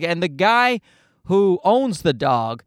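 ordinary talk

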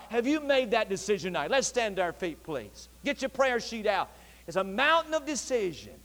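ordinary talk

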